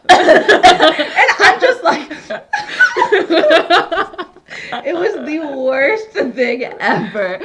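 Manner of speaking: high, slow, laughing through it; helpless laughter. Real, not acted. Laughing hard about the coughing.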